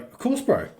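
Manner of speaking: high, breezy, offhand agreement; easy of course. Retold or imitated. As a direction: toss it off like it's nothing at all. Friend agrees to help casually.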